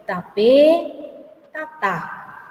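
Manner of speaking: tapé and tatá with the last syllable stretched and stressed; "tapé, tatá" said slowly as reading examples, weight on the endings; stress on final syllable. Shown as a demonstration. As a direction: lean hard on the final vowel of tapé, tatá. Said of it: "Y se lee".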